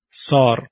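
سار with a long back "aa"; long dark "aa" in سار; long alef. Shown as a demonstration. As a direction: say سار with a tall, vertical mouth.

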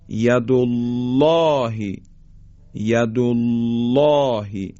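ید الله with thick dark lam, "aw" held long; slow recited ید الله, الله full and rounded; heavy taghliz. Shown as a demonstration. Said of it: "بایستی درشت و با غلظت ادا کنیم".

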